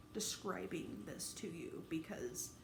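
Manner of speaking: slow, choked whisper; barely getting the words out; near-tearful whisper. Real, not acted. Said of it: "I'm trying so hard not to cry".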